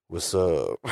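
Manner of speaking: low, laid-back what's up; cool casual greeting. Retold or imitated. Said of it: "Howard, like, literally is like".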